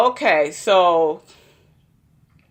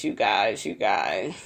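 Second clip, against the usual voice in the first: much lower, softer repeated appeal; dropping low; 11 semitones lower, 7 dB quieter than usual. Real, not acted. Vlogger to viewers about crazy episode, low hushed-down appeal. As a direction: drop right down low and soft.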